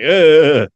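loud, high choked grunt; imitated collapse groan. Describing the announcer collapsing, a strangled groan. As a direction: mimic a man suddenly seizing up. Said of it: "all of a sudden he's just like".

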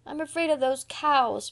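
put-on high, timid little girl; plaintive child's protest. A narrator's reading. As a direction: plead that you're afraid. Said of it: "protested poor Dora".

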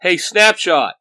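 loud, punchy battle taunt; shout. A narrator's reading. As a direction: shout a challenge at the enemy. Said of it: "he shouts".

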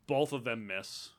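ordinary talk

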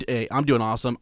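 ordinary delivery

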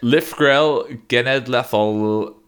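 Llyfrgell Genedlaethol said slowly and loudly, Welsh sounds attempted. Shown as a demonstration. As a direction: sound out Llyfrgell Genedlaethol carefully like a learner, slow and loud. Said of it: "Just gonna try and say it".

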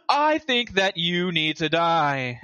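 slow, stilted wizard impression; badly acted wizard. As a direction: pronounce a threat very slowly, like hammy game dialogue. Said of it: "and he's just like".

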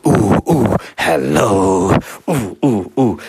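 hoots and a stretched hello; absorbed echo-testing noises; testing the acoustics. Retold or imitated. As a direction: hoot and sing hello at a wall. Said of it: "to the wall".

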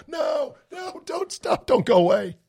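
high shouted pleading, then calming; mock panic. Host begging a listener not to stop listening, mock panic. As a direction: beg them not to leave in a frantic yelp.

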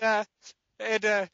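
ordinary talk

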